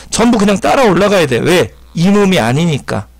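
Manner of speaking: usual speaking voice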